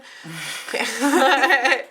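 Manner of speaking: bright burst of laughter; laughing. Real, not acted. Laughing at own joke.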